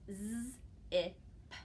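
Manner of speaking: "z, I, p" said sound by sound; stretched out sounds. Shown as a demonstration. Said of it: "I would just stretch it out".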